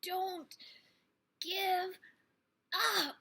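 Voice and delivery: self-encouragement. A narrator's reading. Flora urging herself on.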